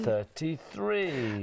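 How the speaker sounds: drawn-out bingo number call; bingo caller. Acted. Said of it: "takes me back to my bingo calling days".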